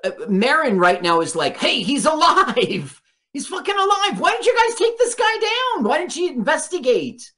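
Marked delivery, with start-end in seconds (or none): 1.6-7.3 high, rising outrage, faster toward the end; demanding answers from the men; pissed-off yelling. Retold or imitated. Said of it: "He's all pissed off".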